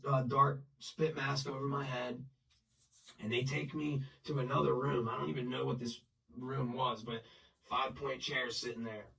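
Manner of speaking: usual speaking voice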